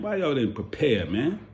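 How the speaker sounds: ordinary delivery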